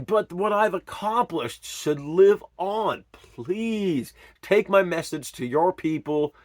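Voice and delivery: loud, high, slow desperate plea; pleading. Retold or imitated. Arrested man loudly begging officers to spread his message. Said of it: "I just plead with them".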